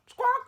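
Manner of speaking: very high, loud squawk; parrot squawk. A narrator's reading. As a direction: screech like a parrot. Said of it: "the parrot responded".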